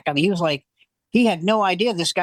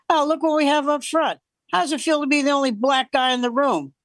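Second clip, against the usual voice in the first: higher-pitched mocking stage heckle; mean, harsh emcee; 7 semitones higher than usual. Emcee to couple up front, mean mock heckle. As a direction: imitate an emcee picking on the front row. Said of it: "he was so mean and, and harsh".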